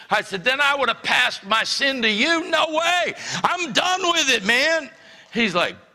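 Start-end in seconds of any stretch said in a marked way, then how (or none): 0.4-4.9 much higher, insistent no way; emphatic refusal. Retold or imitated. Teen refusing to hand over cigarettes, high emphatic. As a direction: refuse it with full conviction.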